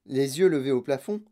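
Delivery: plain reading voice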